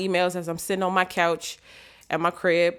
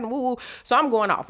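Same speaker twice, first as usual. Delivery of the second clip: usual speaking voice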